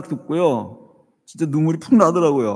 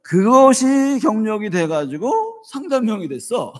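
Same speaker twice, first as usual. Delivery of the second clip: laughing; 7 semitones higher, 4 dB louder than usual. Real, not acted. Laughing at son's army post.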